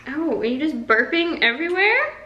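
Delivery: high, playful coo. Real, not acted. Mother teasing her burping newborn in high baby talk.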